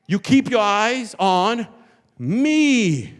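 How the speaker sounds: ordinary talk, nothing marked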